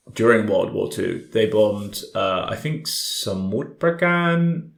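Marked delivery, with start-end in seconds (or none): none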